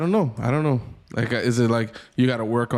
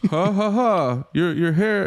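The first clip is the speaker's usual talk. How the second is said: high-pitched fake ha-ha-ha jeer; mock teasing; 8 semitones higher than usual. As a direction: tease with a put-on laugh, pitched up. Retries the teasing joke.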